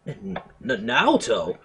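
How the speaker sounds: stammer, then burst out the name loudly; sudden loud cry on the name. A narrator's reading. Startled boy calling out to the detective, loud stammering outburst. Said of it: "more masculine voice ripped through the air".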